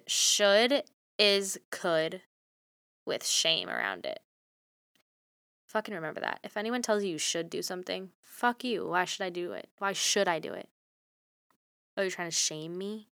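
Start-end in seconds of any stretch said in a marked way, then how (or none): 5.7-13.1 low rant. Real, not acted. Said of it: "Sorry, that was a rant".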